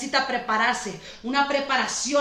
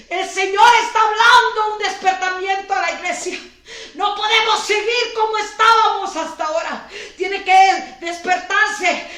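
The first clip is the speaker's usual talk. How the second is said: urgent call; 5 semitones higher, 6 dB louder than usual. Real, not acted. Pastor calling the church to wake up, loud urgent call. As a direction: rouse the sleeping church.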